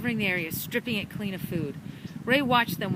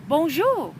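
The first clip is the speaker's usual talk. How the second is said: put-on a touch of French accent; French greeting; 7 semitones higher, 6 dB louder than usual. A narrator's reading.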